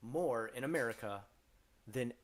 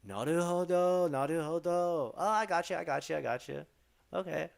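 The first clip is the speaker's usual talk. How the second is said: feigned understanding; 5 semitones higher, 4 dB louder than usual. Pretend. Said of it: "just put those on loop".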